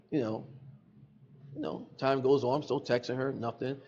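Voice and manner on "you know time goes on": usual speaking voice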